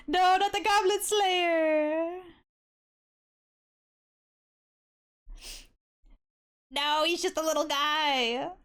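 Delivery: high, slow, cute protest; high cutesy voice. Real, not acted. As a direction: whine it up high. Defending her little guy.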